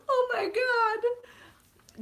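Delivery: high, drawn-out mock sob; demonstrated crying. Shown as a demonstration. Showing how hard Groot made her cry, sobbing. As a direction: whine it through fake tears. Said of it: "my crying level. I'll show you".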